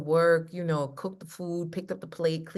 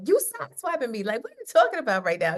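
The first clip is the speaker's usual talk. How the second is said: mock indignant; 5 semitones higher, 5 dB louder, faster than usual. Husband quoted complaining about ambush.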